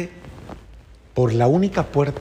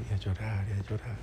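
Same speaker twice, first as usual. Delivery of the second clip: "y a llorar" repeated, softer each time down to a hush; fading repetition; 2 semitones lower, 9 dB quieter, faster than usual. Shown as a demonstration. Priest recalling his tears at Mass, fading into a hush. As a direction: let the weeping words fade away. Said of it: "y yo comencé a llorar".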